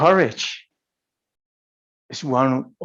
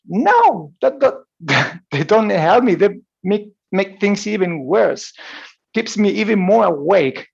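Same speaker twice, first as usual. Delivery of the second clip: retold protest; 6 semitones higher, 3 dB louder than usual. Retold his own protest.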